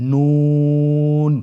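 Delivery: নুন stretched very long, thin and loud; drawn-out letter name. Shown as a demonstration. Teacher introducing the next letter, long drawn-out নুন. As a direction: hold নুন for three counts. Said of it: "তিন আলিফ টেনে পড়তে হবে".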